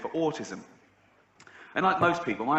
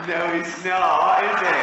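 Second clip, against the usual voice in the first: slow, louder, stretched-out sarcastic jab; drawn-out mocking retort; 4 semitones higher, 7 dB louder, much slower than usual. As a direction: drag it out to ridicule her. Retort repeated as mocking replay.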